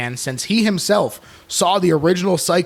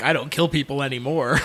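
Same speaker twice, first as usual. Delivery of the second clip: imitating a nervous, chuckling denial; nervous chuckle; 3 dB quieter than usual. Co-host imitating Norman promising he has reformed, nervous chuckle. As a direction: lightly mimic an anxious little chuckle. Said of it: "he does his little nervous chuckle".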